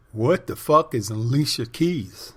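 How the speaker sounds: imitating a baffled musician, slow and loud; incredulous. Musician reacting to a request for a pop song, incredulous.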